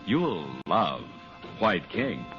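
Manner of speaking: ordinary talk, nothing marked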